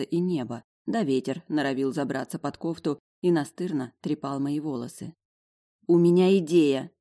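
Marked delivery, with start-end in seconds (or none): none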